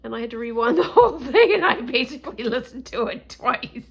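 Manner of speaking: laughing through the words. Real, not acted. Vlogger admits rewinding the show, laughing. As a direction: giggle while confessing.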